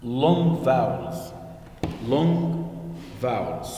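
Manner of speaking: slow, with weight on long; teacher drawing out long vowels; slow stressed term. Shown as a demonstration. Teacher to grade three pupils, slow and stressed. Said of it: "we are going to study".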